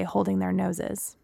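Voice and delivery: plain reading voice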